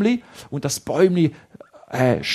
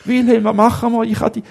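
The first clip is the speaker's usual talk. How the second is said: higher, louder helpless plea; panicked and helpless; boy pleading with his friend; desperate cry; 7 semitones higher, 6 dB louder, faster than usual. Acted.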